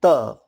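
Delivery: tongue on teeth for त; letter sound t. Shown as a demonstration. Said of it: "इसकी आवाज़ है".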